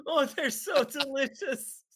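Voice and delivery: mock savoring Aunt May.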